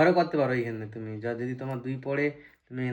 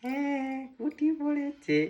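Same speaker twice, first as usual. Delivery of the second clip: excited; slower than usual. Real, not acted. Good dice roll.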